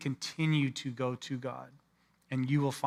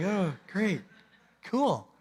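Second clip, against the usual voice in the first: forced cheer; 4 semitones higher, slower than usual. Retold or imitated. Pastor's fake enthusiasm.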